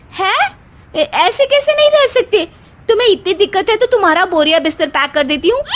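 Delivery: very high, fast indignant protest; wife outraged husband wants them apart; shocked outrage. Acted.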